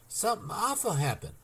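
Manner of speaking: high, breathless terror; gasped fear. A narrator's reading. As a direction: blurt it out frightened. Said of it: "she gasped".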